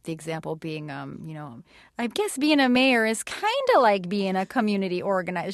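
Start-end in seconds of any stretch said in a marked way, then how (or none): 2.0-5.5 put-on sassy upper-register jab in a Minnesota-tinged accent; Sarah Palin. Comedian shows how the candidate takes a shot at someone, sassy and high. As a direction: pitch it up, pleased with yourself, taking a shot. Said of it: "her voice tends to get higher".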